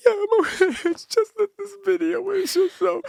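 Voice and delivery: mock-tearful, very high, loud voice; imitated crying. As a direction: blubber through an explanation, hammed up. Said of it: "I'm crying at the table".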